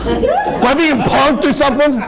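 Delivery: a lightly voiced stunned borrower; disbelieving borrower. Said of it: "He's like".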